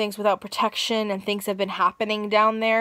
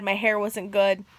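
ordinary delivery

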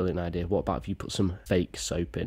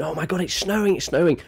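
mock excited scream; 10 semitones higher, 5 dB louder than usual. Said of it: "my dad once coming screaming".

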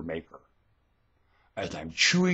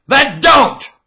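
high, explosive yell; loud, high command; shouted retort; 13 dB louder than usual. Real, not acted. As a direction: throw it back at full volume.

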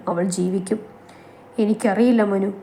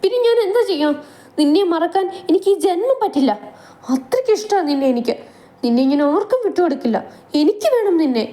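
frantic outburst; 8 semitones higher, faster than usual. Acted. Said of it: "ഭ്രാന്തമായ അവസ്ഥയോടെ വിളിച്ചു പറഞ്ഞു".

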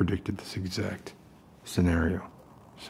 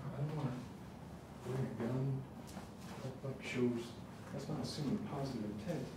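much softer, hurried hushed voice; 15 dB quieter than usual. Real, not acted. Sneaking out with gun and gas mask, hushed. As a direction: murmur fast so nobody hears.

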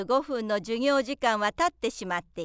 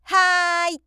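much louder, higher drawn-out yes; very loud reply; 7 semitones higher, 8 dB louder, slower than usual. A narrator's reading. Show-off boy answers his own name louder still. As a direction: shout the reply even bigger. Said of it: "一層大声で".

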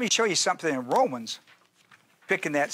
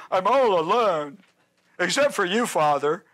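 lightly whiny, self-pitying Elijah; whining Elijah; 3 dB louder than usual. Retold or imitated.

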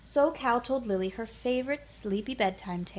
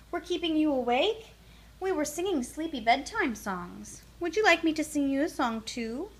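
higher, quicker chatty duck; friendly duck voice; 6 semitones higher than usual. Acted. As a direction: voice a cheerful little duck. Duck speaks to Lily.

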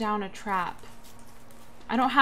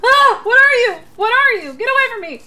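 panicked high screaming; being chased; 13 semitones higher, 14 dB louder than usual. Real, not acted. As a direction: scream in terror while fleeing. Monster chasing him in game.

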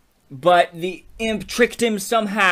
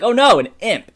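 higher, louder exclamation of dismay; mock alarm; 7 semitones higher, 8 dB louder than usual. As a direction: cry out in acted alarm at a discovery. Acted alarm on finding imp.

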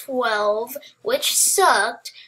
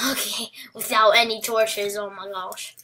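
ordinary talk

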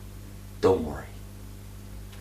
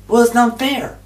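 ordinary talk, nothing marked

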